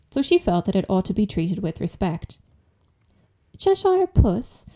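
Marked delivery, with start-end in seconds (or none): 3.6-4.7 high, slow and timid; a shy little girl's voice. A narrator's reading. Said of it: "rather timidly".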